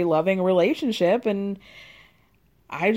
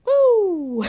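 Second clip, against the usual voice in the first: excited whoop; 10 semitones higher, 6 dB louder than usual. Real, not acted. Reacting to the purse price.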